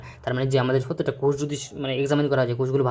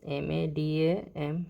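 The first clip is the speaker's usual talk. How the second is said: slow letter-by-letter spelling of এম এ ডি এ এম; 2 semitones higher, 4 dB quieter, much slower than usual. Shown as a demonstration. Teacher spelling madam for the class, slowly. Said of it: "এরকমভাবে madam".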